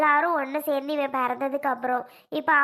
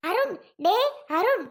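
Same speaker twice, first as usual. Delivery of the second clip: calling out; 6 semitones higher than usual. Acted. Mother calls son from inside.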